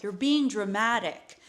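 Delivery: voiced, insistent mock scolding; exasperated retold reproach, out loud; dismissive scolding. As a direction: imitate an impatient boyfriend brushing off the pain. Boyfriend scolds her, acted.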